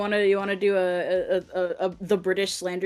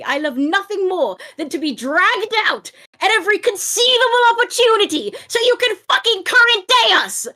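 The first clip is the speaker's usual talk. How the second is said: high, shouted British tirade, building to a yell; loud British-accented rant; 14 semitones higher, 7 dB louder than usual. Acted. Friend performing the pronouns rant on request, furious British accent. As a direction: explode into outraged British ranting. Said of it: "it requires me to get loud".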